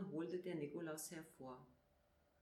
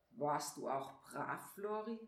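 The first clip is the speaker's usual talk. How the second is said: stern; 4 semitones lower than usual. A narrator's reading. Nikolaus questions little Flori about behaving, stern. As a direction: question the child with mild severity. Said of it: "so streng wie noch nie".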